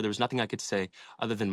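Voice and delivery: ordinary delivery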